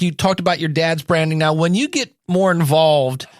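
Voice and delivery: normal talking voice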